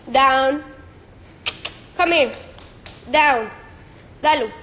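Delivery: loud firm dog command. Real, not acted. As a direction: order the dog down firmly.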